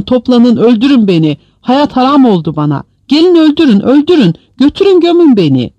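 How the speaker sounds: anguished pleading that climbs to a loud, high peak; heartrending outcry. A narrator's reading. Prisoner calls on loved ones and begs death, anguished cry. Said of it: "canhıraş bir bağırtı izledi".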